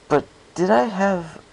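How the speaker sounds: usual speaking voice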